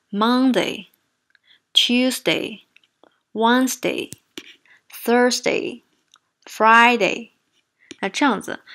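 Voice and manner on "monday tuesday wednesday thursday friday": "Monday" to "Friday", stressed first syllable loudly stretched; dragged syllables. Shown as a demonstration. Teacher imitating students, overlong dragged stress. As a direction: drag out the first beat of each weekday. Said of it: "我来演示一下他们会怎么做".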